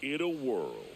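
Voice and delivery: low, slow epic trailer narration; movie trailer voice. Pretend. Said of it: "cue the movie trailer voice".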